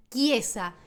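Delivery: "chiesa" as kye-za; Italian pronunciation. Shown as a demonstration. Teacher drilling "church", "chiesa" with k sound. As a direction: say "chiesa" with hard k, falling. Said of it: "todos los ejemplos sin interrupciones".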